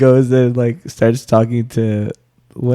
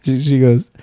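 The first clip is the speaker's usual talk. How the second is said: ordinary delivery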